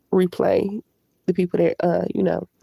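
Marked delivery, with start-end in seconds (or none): none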